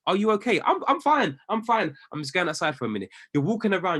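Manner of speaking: ordinary delivery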